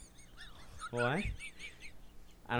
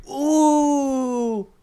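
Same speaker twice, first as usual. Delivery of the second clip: delighted coo; 14 semitones higher, 10 dB louder than usual. Retold or imitated. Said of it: "She's like".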